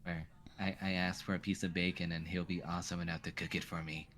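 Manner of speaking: a soft, quiet take on a mild friend; a kid mimicking someone gentle and humble; mock Paul. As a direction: kid quietly imitating a meek companion. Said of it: "Paul sounds like this".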